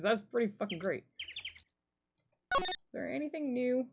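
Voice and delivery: ordinary talk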